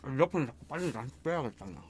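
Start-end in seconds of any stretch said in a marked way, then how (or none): none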